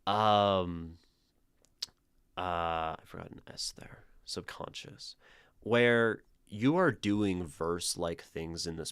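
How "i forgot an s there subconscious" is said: quick unvoiced aside to herself; muttered aside. Real, not acted. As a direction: whisper it under your breath, fast. Whispered aside to self.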